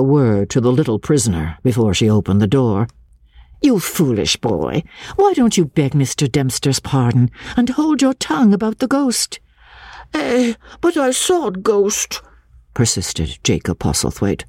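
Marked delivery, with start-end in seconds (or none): 3.6-9.4 scolding lady. A narrator's reading. Halcombe scolds the boy. 10.1-12.2 put-on high, slow boy's voice; a scared child insisting; frightened boy. A narrator's reading. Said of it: "with a stare of terror".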